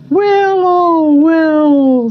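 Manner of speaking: drawn-out warble sliding down; magpies. A narrator's reading. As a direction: carol it like a magpie. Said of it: "said the magpies".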